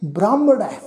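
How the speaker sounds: ਬ੍ਰਾਹਮਣ ਹੈ with an "ai" ending; traditional pronunciation. Shown as a demonstration. Teacher tells the congregation how to read it, ਬ੍ਰਾਹਮਣ ਹੈ in "ai". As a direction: carefully model ਬ੍ਰਾਹਮਣ ਹੈ, falling on "-nai". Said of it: "ਜਿਹੜਾ ਉਚਾਰਨ ਕਰਾਂਗੇ ਜੀ".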